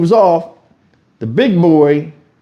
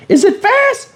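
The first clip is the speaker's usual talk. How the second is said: mocking question; slower than usual. Mocking the kid with stick.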